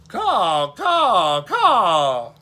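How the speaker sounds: loud, high crow caws; drawn-out high cawing. A narrator's reading. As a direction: caw out like a crow, full volume. Child imitates a crow.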